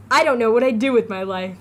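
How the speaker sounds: loud, grand title call; show title announcement. Shown as a demonstration. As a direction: announce the show name like a host. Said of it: "my official new name for my show".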